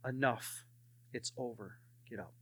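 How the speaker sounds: low, hushed, measured command; the Lord's voice. Retold or imitated. The Lord speaks to a sobbing prisoner, quiet and low. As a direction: say it very softly and low, with pauses. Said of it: "And the Lord said".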